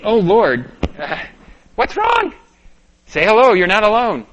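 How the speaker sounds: loud and high, rising panic; panicked thoughts. Retold or imitated. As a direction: cry out to the Lord in alarm. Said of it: "And I'm thinking".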